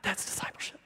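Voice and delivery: breathy whispered aside to himself; whispered thought. Retold or imitated. Pastor thinking to himself while scrolling Facebook, whispered.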